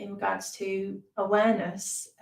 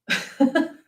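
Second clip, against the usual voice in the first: short, higher-pitched, louder laugh; 5 semitones higher, 6 dB louder than usual. Real, not acted. Meditation teacher laughs at trashing the place, laughing. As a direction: burst of real laughter at a small mishap. Knocked something over, laughs at mishap.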